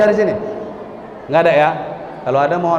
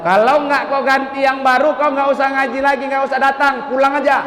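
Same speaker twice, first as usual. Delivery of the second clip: scolding the pupil; 7 semitones higher than usual. Retold or imitated. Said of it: "Saya bilang sama anak tadi".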